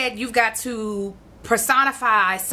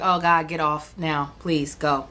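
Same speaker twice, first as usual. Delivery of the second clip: retold pleading dismissal; 7 semitones lower than usual. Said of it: "I was like".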